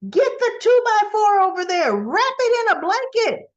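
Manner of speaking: high, loud mock-director yell; shouted order. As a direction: yell an urgent improvised fix across the set. Acted frantic order on a set.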